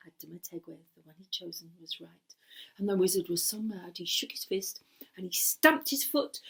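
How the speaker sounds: plain reading voice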